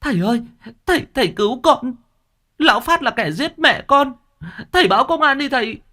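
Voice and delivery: hét thật to, giọng vọt rất cao; van xin cứu mạng, hét lên hoảng loạn; hét lên cầu cứu. A narrator's reading. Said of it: "Con Mận hét lên". (cô gái hét lên cầu cứu thầy)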